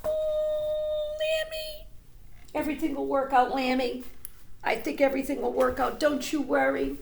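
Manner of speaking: mock tearful little girl; Pearl crying. A narrator's reading. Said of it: "Pearl was crying".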